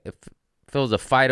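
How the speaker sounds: usual speaking voice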